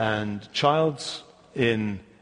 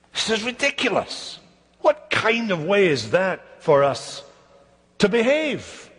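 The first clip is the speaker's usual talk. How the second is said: higher-pitched, mildly indignant scoffing; scornful objection; 4 semitones higher than usual. Acted. Scoffers answering Isaiah's call to trust God, mildly indignant. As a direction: voice the scoffers' disbelief, stretching the question.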